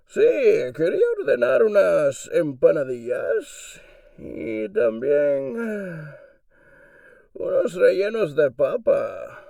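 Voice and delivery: menacing Bane calmly ordering Puerto Rican dishes, muffled through a cup; Bane ordering food. Acted. Bane phones a restaurant to order food: loud muffled villain voice. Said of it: "So here is Bane ordering some food in Spanish".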